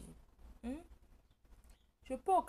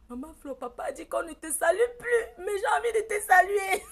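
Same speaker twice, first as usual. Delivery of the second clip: aiguë et forte, en sanglotant; voix d'enfant qui pleure; 10 semitones higher, 13 dB louder than usual. Retold or imitated. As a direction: crie sa peine comme une petite fille. L'enfant salue la tata en cachette. (la fille supplie sa tata en pleurant et criant)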